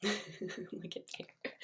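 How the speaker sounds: slow laughing whisper. Real, not acted. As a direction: giggle it under your breath. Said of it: "aside while Bear sleeps".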